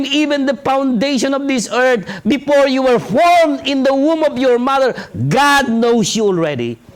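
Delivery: usual speaking voice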